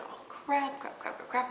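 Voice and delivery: hushed, retold mock dread; muttered dread. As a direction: mutter it to yourself, dropping to a whisper. Retold dread of eye test.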